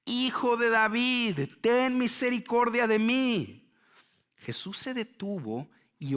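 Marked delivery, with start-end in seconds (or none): 0.0-3.7 slow, high, loud drawn-out cry; shouting much harder. Acted. Hushed by the crowd, blind beggar persists, shouting much harder. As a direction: stretch the plea into an insistent shout. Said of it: "él gritaba mucho más".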